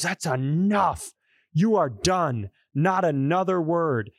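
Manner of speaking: retold scream. Dad who pulled the car over, mock-screaming at his son. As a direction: imitate an enraged father laying down the law. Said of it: "and just screams".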